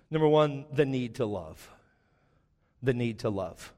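ordinary delivery

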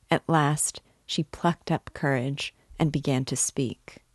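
usual speaking voice

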